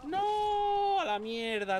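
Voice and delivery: high, loud cry of no; desperate shout. Real, not acted. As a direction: yell it in despair. Dies, loses forty thousand souls.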